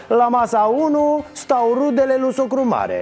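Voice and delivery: high-pitched roll call of wedding tables; mock seating announcement. Host seating relatives as at a wedding, mock announcing.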